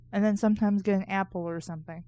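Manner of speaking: normal talking voice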